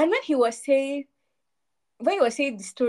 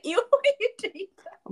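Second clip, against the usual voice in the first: high-pitched "ew" breaking into a laugh; amused, grossed-out reaction; mock disgust; 9 semitones higher than usual. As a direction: recoil in playful, squeaky disgust. Acted disgusted reaction to romance.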